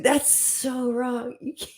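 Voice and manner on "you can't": usual speaking voice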